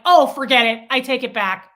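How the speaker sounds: loud, fed-up retort; re-enacted burst of annoyance; indignant takeback. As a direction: snap back loudly at someone rude. Acts snapping at a rude stranger.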